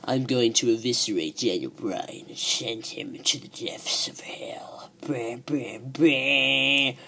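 low, soft, slow menacing whisper; eerie villain whisper. Retold or imitated. Retelling a masked wrestler's threat, eerie whisper. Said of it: "picks it up, and says".

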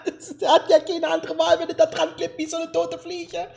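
high voice, words pushed through laughter; cracking up. Real, not acted. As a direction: keep talking while laughing helplessly. Cracking up at the story. (sich beim Reden kaputtlachen)

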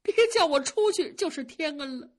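voiced servant girl begging for mercy; pleading maid. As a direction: plead desperately not to be sent away. Maid begging not to be dismissed.

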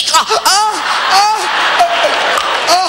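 rising panicky ah ah cries; startled yelps. Retold or imitated. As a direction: yelp as the car brakes hard. Braking while doing makeup.

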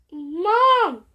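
loud drawn-out call; calling Mom. Acted. Child calls out to mom.

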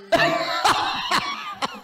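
sudden very high shriek of a laugh; squealing cackle. Real, not acted. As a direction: lose it completely. Bursts out laughing.

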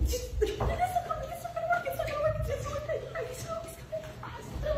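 high, laughing panic as it falls apart; panicked laughing. Acted. Caught by her partner, she laughs nervously and high.

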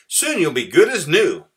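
high, loud, chirpy mouse; much too cheerful. A narrator's reading. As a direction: cheer up a patient far too brightly. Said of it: "Mouse was much too cheerful".

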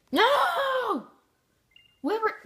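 loud high shout of defeat; frustrated shout. Real, not acted. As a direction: yell at the loss. Losing near twenty.

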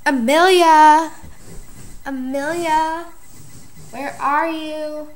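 high, slow calling out a name. Pretend. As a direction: shout across the house for her. Dorothy calling out for Amelia.

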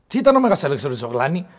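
loud, rapid demand from a bullying instructor; angry interrogation. Acted. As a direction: hurl the question at the pupil, loud and fast. Lifeguard quizzing boy between slaps. (δυνατή, γρήγορη αυστηρή ερώτηση)

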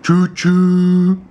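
loud, drawn-out train call; playful train whistle. Pretend. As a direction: sound the whistle for a toddler. Imitating a train whistle for child.

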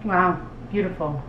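ordinary talk, nothing marked

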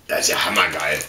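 ordinary delivery

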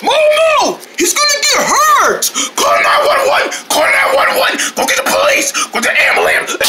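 high-pitched panicked yelling; frantic, shrieked alarm; freaking out. Acted. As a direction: scream for help in a frenzy. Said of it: "what you get for freaking out so much".

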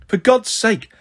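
a touch of an exasperated mum calling through. A narrator's reading. Mum to yelling children from the bathroom, calling out. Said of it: "Mum called from the bathroom".

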